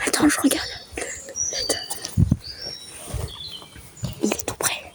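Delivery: breathy hiding whisper; whisper, barely audible, hiding; whispering while hiding. Real, not acted. (chuchoté en se cachant)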